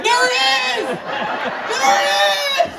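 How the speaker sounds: shrieking at the sight; excited shriek. Retold or imitated. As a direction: yelp like you just spotted it. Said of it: "I'm like".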